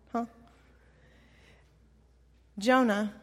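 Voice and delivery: ordinary delivery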